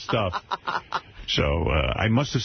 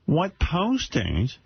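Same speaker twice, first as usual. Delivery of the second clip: high, very slow mom voice on posters; imitating his mother; 8 semitones higher, much slower than usual. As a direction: stretch out posters with a rising tune as mom. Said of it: "I could do an impression of my mother".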